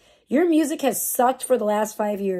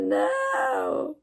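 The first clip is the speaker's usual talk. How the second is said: much higher hammed-up yell of despair; mock anguished shout; 14 semitones higher than usual. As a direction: cry out in fake tragedy. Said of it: "I would have been like".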